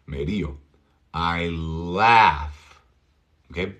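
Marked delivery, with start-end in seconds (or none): 1.1-2.8 stretched "I laugh", front short A; teacher's deliberate "I laugh"; slow laugh model. Shown as a demonstration. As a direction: draw out "laugh" for learners. Teacher models phrase slowly.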